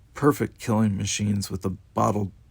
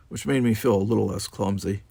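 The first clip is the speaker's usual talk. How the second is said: ordinary delivery, nothing marked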